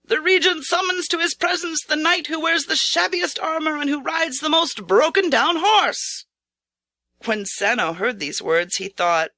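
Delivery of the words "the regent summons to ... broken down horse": high, ringing herald's call; a herald announcing to the gathered knights, calling aloud. A narrator's reading. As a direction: call it out across the ranks. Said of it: "he called aloud".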